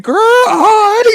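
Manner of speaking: laughing yell. Retold or imitated. Co-host retelling his reaction, laughing yell. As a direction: shriek it through laughter. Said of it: "I said".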